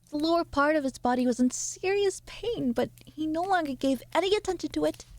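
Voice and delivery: even, measured reading-aloud voice; steady narrator reading a classic; story narration. A narrator's reading. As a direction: read it like a storyteller to an audience. Reading Kafka aloud on stream.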